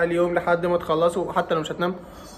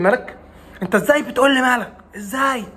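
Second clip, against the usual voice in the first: louder, higher, snapping back at a simple question; mock cranky outburst; 5 semitones higher, 3 dB louder than usual. Sleepless student snapping at someone who asks what's wrong, mock angry. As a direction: play the sleepless student who bites everyone's head off. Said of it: "انت بتقول لي".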